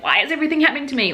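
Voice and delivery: high, rushed comic lament; mock exasperation. Reviewer voicing a comedy-of-errors heroine, mock exasperated.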